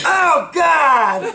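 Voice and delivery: high, loud mock yelp of "Oh, God!"; burned client crying out, laughing; retold yelp of pain. Client yelps at the stylist after the burn, high and loud. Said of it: "She goes".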